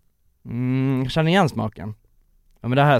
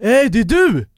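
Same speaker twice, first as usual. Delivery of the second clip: high, bright greeting voice from the car window; excited shout of recognition; cheerful guy; 7 semitones higher, 4 dB louder than usual. Retold or imitated. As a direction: call out like a lad who knows you. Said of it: "så han bara".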